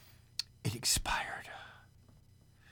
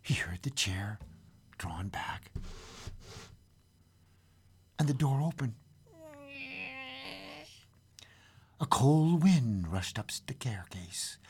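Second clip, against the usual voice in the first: hushed storytelling; 3 semitones higher, 4 dB quieter than usual. A narrator's reading. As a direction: let it settle into eerie quiet. Narrator's hushed aftermath.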